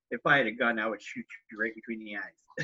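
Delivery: low, hushed mock menace; a hint of a wife seething under her breath; deadly calm threat. Wife glares at husband, low hushed mock threat. Wife's silent murderous look.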